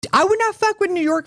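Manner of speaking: emphatic warning. Real, not acted. Warning listeners.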